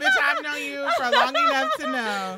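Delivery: high and loud through laughter; laughing. Real, not acted. Laughing comeback to a friend who doubts her. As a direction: crack up while firing back.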